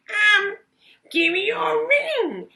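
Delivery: very high, loud goblin voice, slow on the ring; a pushy little goblin naming his price; sly goblin. Acted. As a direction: goblin bargaining for her ring. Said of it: "he said".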